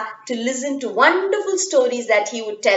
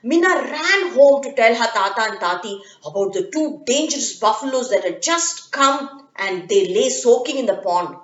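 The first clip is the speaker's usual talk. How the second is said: plain reading voice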